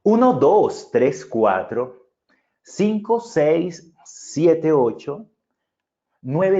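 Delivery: showing off body language while counting; energetic projected count. Shown as a demonstration. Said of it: "Va a quedar de la siguiente manera".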